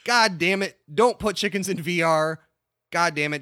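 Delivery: mock outraged rant. Real, not acted. Podcast host loudly ranting to stop chicken VR talk. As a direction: shout it down like a furious host.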